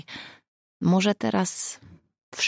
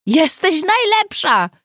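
cheering; 13 semitones higher, 8 dB louder, slower than usual. A narrator's reading. Cheering friend on big news.

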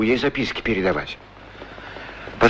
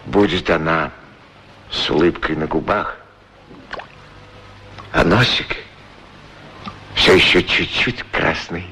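dreamy tenderness; 6 semitones lower than usual. Acted. Dreaming of her arrival.

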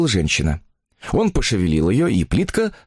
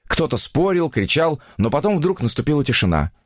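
ordinary delivery

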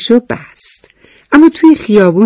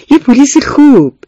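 put-on high, loud proud little boy; a young boy's proud declaration of his dream; proud child; 10 semitones higher, 6 dB louder than usual. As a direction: announce it like a proud kid.

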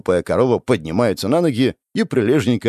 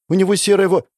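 put-on eager girl; 9 semitones higher, 4 dB louder, faster than usual.

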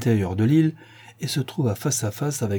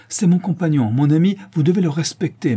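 higher and louder, insisting on respect; raised voice; 7 semitones higher, 5 dB louder than usual. A narrator's reading. Doctor scolds the boatman about his dog, raised voice. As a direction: raise your voice to defend the dog. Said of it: "en élevant la voix".

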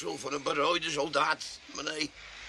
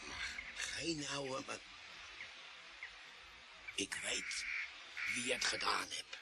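much softer, lower secret; hushed confiding; 6 semitones lower, 14 dB quieter than usual. Acted. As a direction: lean in and confide it. Tramp drops to confiding voice.

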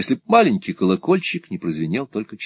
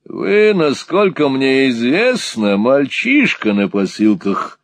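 high, loud, drawn-out contempt; scornful retort; 6 semitones higher, 5 dB louder, slower than usual. Acted. Sir Mulberry putting Nicholas down, scornful retort. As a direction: sneer it slowly in a raised pitch.

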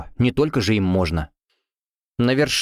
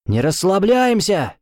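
urging call; 8 semitones higher, slower than usual. A narrator's reading. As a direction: drive the team on. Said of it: "подгонял Финн".

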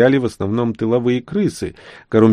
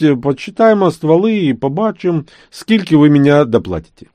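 put-on Surzhyk accent; 6 semitones higher, 5 dB louder than usual. Nazar haggling, mixed Ukrainian.

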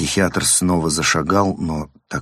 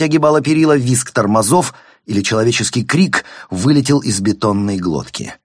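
raised, driven chase narration; urgent story narration; 5 semitones higher, 5 dB louder than usual. A narrator's reading. Narrator tells of a chase through Paris traffic, urgent. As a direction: push the action forward with mounting urgency.

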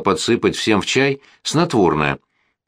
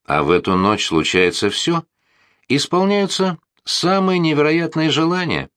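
solemn announcement; 8 semitones higher than usual. Acted. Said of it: "торжественно заявил полковник".

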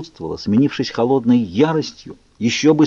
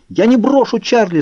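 much higher, louder yell; 12 semitones higher, 4 dB louder than usual. A narrator's reading. Bella refuses to abandon her father, loud yell. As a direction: scream it at him, refusing. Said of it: "заорала я".

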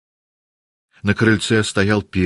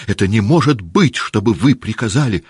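higher and louder indignant cry; outraged shout; 4 semitones higher, 4 dB louder than usual. A narrator's reading. Natasha bursts in on her mother, outraged shout. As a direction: hurl it at mother in disbelief. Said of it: "закричала она".

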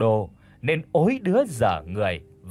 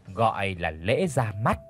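ordinary delivery, nothing marked

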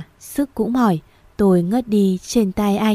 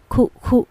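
two dry coughs, acted; coughing; 4 semitones higher than usual. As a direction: cough like a sick grandmother. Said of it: "Tiếng ho của nội". (tiếng ho khan của bà nội)

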